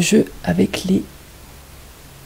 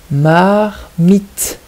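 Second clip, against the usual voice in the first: "Marmite" with a long "Mar", then a separate "mite"; syllable by syllable; 4 dB louder than usual. Shown as a demonstration. Teacher giving the odd word out, "Marmite" in two syllables. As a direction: draw out "Mar" and set "mite" apart. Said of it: "il faut bien écouter la deuxième syllabe".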